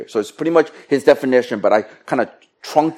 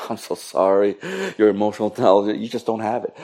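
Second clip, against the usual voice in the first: mock sympathy; 4 semitones lower, 7 dB quieter than usual. Acts out pitying verdict on listener.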